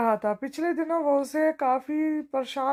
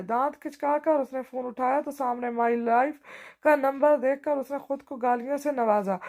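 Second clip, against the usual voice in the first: plain reading voice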